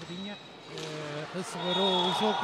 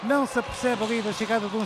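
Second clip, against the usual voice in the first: louder, higher match call; fast dramatic football commentary; 5 semitones higher, 7 dB louder than usual. Real, not acted. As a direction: call the shot and save live. Live play-by-play.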